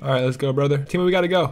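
usual speaking voice

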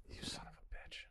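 breathy whispered curse to himself; frustrated whisper. Real, not acted. Stumped player curses the question in a whisper. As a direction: hiss it under your breath.

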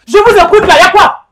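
shouting. Acted. Commissioner yelling at suspect.

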